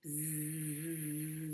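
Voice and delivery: soft low bee buzz; quiet imitated buzz. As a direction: buzz like a wasp. Yellow jacket buzzes.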